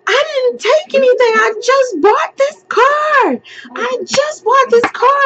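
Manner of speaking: high, panicked and fast, near tears; frantic pleading. Retold or imitated. Arrested driver pleading with the officer, high and panicked. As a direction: beg the officer like you can't breathe. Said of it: "like, freaking the fuck out".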